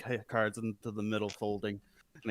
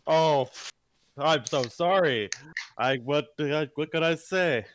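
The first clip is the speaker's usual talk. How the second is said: louder, higher, slow fake sorry; mock apology; 4 semitones higher, 6 dB louder, slower than usual. Card player fakes an apology to his opponent. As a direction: pretend to be sorry.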